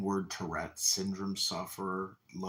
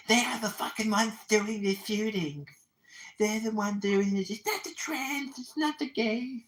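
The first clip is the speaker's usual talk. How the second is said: mocking Adams; 4 semitones higher than usual. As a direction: parody a preachy activist building to a yell. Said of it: "going on and on and on".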